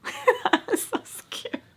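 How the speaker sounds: real laughter breaking through the words; giggling at the scary memory; laughing. Real, not acted. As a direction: laugh it out high and breathy. Laughing while retelling a fright.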